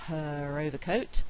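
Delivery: "her overcoat" slowly, R linking "her" to "overcoat". Shown as a demonstration. Lecturer giving another linking example, R sounded. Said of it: "I'm likely to say the R".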